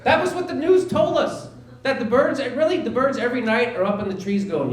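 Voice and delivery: usual speaking voice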